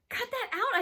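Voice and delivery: loud, very high protest; angry order. Acted. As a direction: shout to make her stop. Host orders friend to stop throwing.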